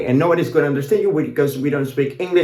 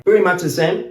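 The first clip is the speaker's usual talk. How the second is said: ordinary delivery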